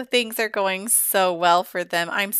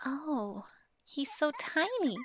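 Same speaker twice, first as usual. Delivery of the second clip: put-on whispered, higher little-girl voice; voicing the toddler; 6 semitones higher, 10 dB quieter than usual. Toddler Eden coos over her new baby brother, whispered. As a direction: hush it like a toddler meeting a baby. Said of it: "She's like".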